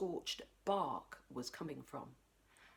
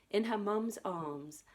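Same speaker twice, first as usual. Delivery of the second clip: ordinary delivery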